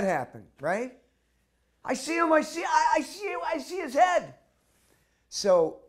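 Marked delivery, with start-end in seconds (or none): 1.8-4.3 high, loud, ecstatic shouts; yelling at the top of his voice over the crowd; excited yelling. Retold or imitated. A soldier on a chair yells over the crowd, very high and loud. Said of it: "he starts yelling".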